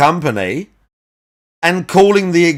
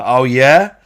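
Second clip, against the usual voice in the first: ordinary talk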